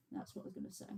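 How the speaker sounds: breathy whispered aside to herself; whispered reminder to herself. Real, not acted. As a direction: drop to a whisper, as if remembering.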